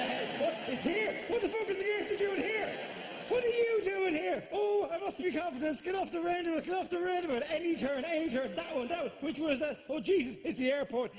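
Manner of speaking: high, frantic squeals of a lost driver; panicked driver. Acted. Comic lost at a roundabout, panicked high shrieking. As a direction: shriek it like you've lost control of the car.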